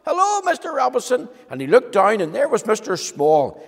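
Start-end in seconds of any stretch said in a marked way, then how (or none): 0.0-1.3 high tiny voice; a tiny man calling up from the doorstep; little voice. Acted. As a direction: squeak it like someone as small as a pin. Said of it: "he heard a little voice".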